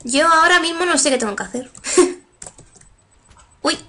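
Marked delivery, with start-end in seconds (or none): none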